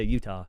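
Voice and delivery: low-key "Utah" with the T swallowed; dropped T in Utah. Shown as a demonstration. Local demonstrating the tang, low "Utah" with no clear T. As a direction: say "Utah" without a crisp T. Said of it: "you don't say the T in Utah".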